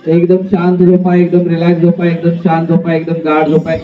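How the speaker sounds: low, fading soothing sleep commands; hypnotist voice. Acted. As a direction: lull them back into sleep.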